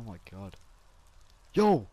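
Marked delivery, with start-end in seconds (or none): 1.5-1.9 shouting across, high and loud; calling out. Acted. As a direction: call out to a distant friend. Calling out to missing friend.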